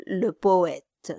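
ordinary delivery, nothing marked

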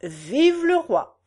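slow, high declaimed reply; drawn-out campaign slogan; candidate's echo. A narrator's reading. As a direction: echo the cheer as an orator. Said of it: "Le candidat répondit".

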